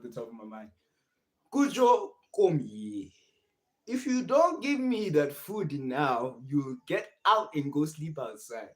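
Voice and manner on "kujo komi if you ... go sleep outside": high, slow, hammed-up Nigerian accent; mock Nigerian accent. Streamer jokingly imitates a Nigerian viewer's accent, higher and louder. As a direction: play a scolding Nigerian parent, accent laid on thick. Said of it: "how do you say that accent in Nigeria".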